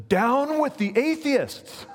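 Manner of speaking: high, loud, slow and defiant; defiant retort. Retold or imitated. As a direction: turn the words back on the crowd. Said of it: "he gestured towards them, and he says".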